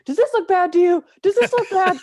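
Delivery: high, loud hammed-up panicked yell; mock screaming. Camper shows his leg to the ranger, mock screaming. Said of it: "screaming at the ranger".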